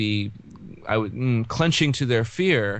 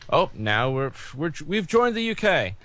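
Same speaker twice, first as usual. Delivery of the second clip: higher-pitched mock announcement of joining up; mock new Briton; 5 semitones higher than usual. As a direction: lightly play a newly converted UK citizen. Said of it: "you dropped your Scottish accent".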